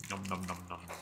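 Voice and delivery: soft mock munching noises, mouth full; nom nom chomping. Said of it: "it's A S M R time".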